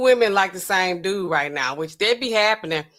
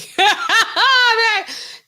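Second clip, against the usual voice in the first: loud high burst of laughter; real squeal of laughter; 6 dB louder than usual. Real, not acted. Laughing at her own fantasy.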